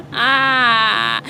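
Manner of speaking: real giggling; laughing. Real, not acted. Laughing after joke.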